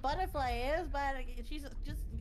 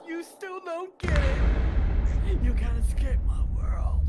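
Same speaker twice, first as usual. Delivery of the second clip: creepy villain; 2 semitones higher, 13 dB louder, much slower than usual. Acted. Creepy taunt in horror game.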